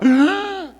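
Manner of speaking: loud, high gasp for air; mock drowning gasp; imitated surfacing gasp. Surfacing from water.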